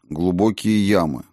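plain reading voice

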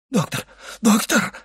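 high-pitched repeated call; crying out. A narrator's reading. Servant feels a new fit coming, crying out. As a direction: call for help, rising on the second cry. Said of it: "крикнул Барруа".

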